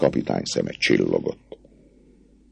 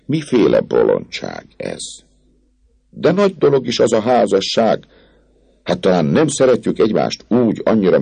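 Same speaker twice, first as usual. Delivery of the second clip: higher, cajoling officer's voice; the captain coaxing the girl out of marriage talk; persuasive captain; 6 semitones higher than usual. A narrator's reading. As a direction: talk her round with charm. Phoebus persuading.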